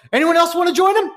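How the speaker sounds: imitated high, loud arena shout; Bill's challenge to the crowd. Umpire imitating his partner turning to the audience, loud challenge. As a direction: hurl a mock gladiator challenge at the stands. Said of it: "Bill, almost like, uh, you guys seen the movie Gladiator".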